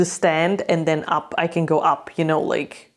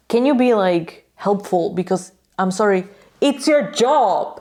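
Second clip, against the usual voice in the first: exasperated. Real, not acted. Fan ranting at the rude steward, exasperated.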